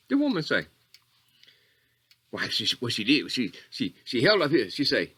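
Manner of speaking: usual speaking voice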